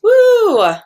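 put-on cheering to show kids excitement; excited woo. Shown as a demonstration. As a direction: whoop it up like a party. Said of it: "How about excited?".

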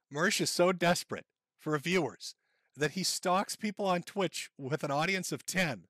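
normal talking voice

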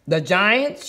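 plain reading voice

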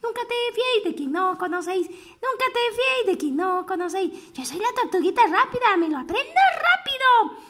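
quick high recitation; fast little turtle. Acted. Little turtle showing off the saying, quick and high. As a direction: rattle it off proudly like a quick child.

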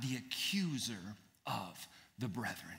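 ominous whisper. Acted. Preacher names the devil's title in a hushed whisper. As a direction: let the title hang darkly.

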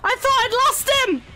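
high, loud relief; relieved outburst. Real, not acted. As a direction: blurt it out after a close escape. Chase panic in game.

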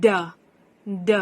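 "d" as its phonics sound, duh; letter sound d. Shown as a demonstration. Teacher sounds out the letter for children, letter sound d. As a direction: say "d" as a short isolated consonant sound. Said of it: "ที่ให้เสียง".

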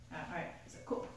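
breathy whisper, cool cool; whispered mock nonchalance. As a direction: whisper it, pretending to shrug off. Whispered cool after mom voice.

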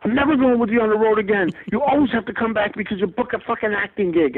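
wife fuming about the cut-short trip, high and mock-angry; imitating angry wife. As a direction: rant like the fed-up wife. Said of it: "she was so mad".

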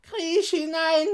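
high, slow, loud witch command. A narrator's reading. Witch ordering Gretel into the oven, commanding. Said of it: "sagte die Hexe".

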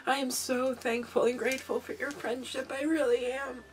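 soft, heartfelt, fighting back tears; tearful gratitude. Real, not acted. Crafter grateful for a friend's friendship, tearful. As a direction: thank them quietly while still teary. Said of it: "I'm gonna stop crying now".

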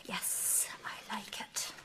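breathy hushed whisper, barely voiced. Real, not acted. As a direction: whisper it as if afraid to be overheard. Awkward hushed reply about imagery.

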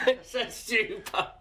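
laughing through the words; giggling at her own mistake. Real, not acted. Laughing at mistaking a stew pan.

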